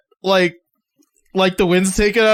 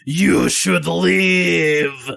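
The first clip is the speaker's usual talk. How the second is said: menacing threat; much slower than usual. Acted. Mage with flaming hands coolly warns the underling, slow menace. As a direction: let each word hang like a threat. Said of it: "just goes".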